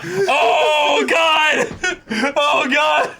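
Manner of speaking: excited shout. Real, not acted. Reacting to anime scene.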